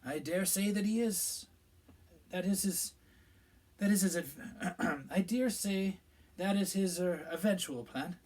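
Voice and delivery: halting, hesitant dodge; evasively. A narrator's reading. Minister dodging the landlady's question, evasive and halting. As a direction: stumble and hedge your answer. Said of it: "said Fudge evasively".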